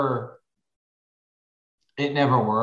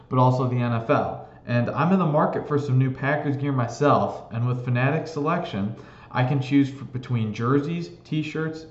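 upbeat sponsor ad read; infomercial. Acted. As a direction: sell the merch like a commercial. Co-host reads sponsor ad.

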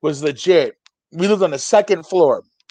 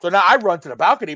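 usual speaking voice

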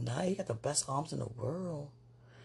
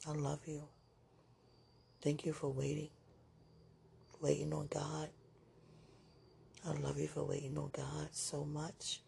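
soft, sincere declaration of love; hushed, gentle thanks to a sweetheart; tender; 11 dB quieter than usual. Real, not acted.

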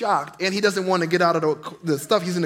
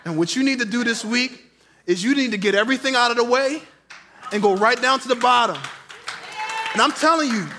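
ordinary delivery

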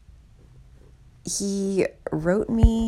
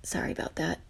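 whispered aside; 8 dB quieter, faster than usual. Real, not acted. Aside after pausing recording.

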